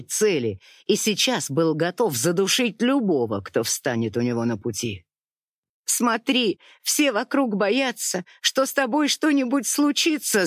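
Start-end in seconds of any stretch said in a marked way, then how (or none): 5.8-10.5 higher, tearful plea; tearful pleading. A narrator's reading. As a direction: plead through tears. Tearful wife pleads with husband. (сквозь слёзы, выше)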